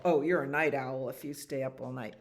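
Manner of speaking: plain reading voice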